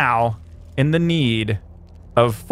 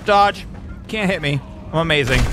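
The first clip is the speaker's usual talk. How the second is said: normal talking voice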